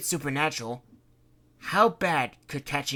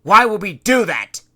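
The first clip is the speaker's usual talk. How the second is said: exasperated; 9 semitones higher, 17 dB louder than usual. Acted. Fred baffled, arguing.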